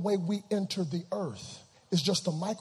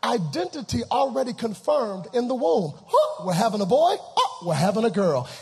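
loud, driving pulpit delivery; full-force preaching; 6 semitones higher, 9 dB louder than usual. Acted. Preacher recounts birth to congregation, full-force preaching. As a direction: fire rhetorical questions at the congregation.

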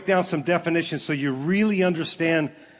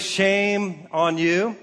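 slow scolding; much slower than usual. Shown as a demonstration. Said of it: "We've all heard the phrase".

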